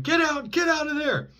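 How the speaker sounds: high, loud mock police shout; imitating a cop yelling. Cop yelling at the suspect under the car, high mock shout. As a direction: bark it like a cop chasing someone. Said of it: "the police were yelling at him".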